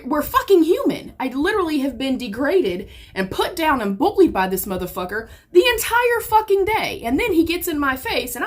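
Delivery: heated, high-pitched venting; real indignation. Real, not acted. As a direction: protest hard, voice jumping up on the key words. Venting about being bullied all day.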